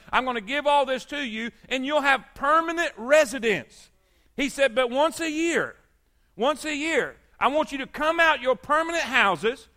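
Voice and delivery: emphatic pulpit delivery, hammering repeated phrases; impassioned preaching. Acted. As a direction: proclaim God's instructions with rising force. Preaches with raised voice.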